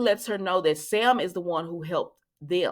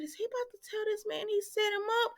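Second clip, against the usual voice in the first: shocked gasp; 7 dB quieter, faster than usual. Retold or imitated. Own shocked reaction to scene.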